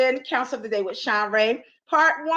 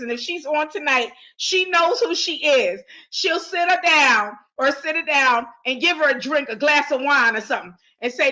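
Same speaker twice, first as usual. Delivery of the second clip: ordinary delivery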